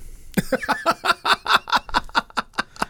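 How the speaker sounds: long, high run of laughter; real sustained high laughing fit; punchline laugh. Real, not acted. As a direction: crack up at the dirty punchline. Laughing after the joke's punchline.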